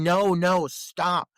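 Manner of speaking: retold cry of protest, pushed up in pitch; imitated scream. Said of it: "and her screams, she's saying".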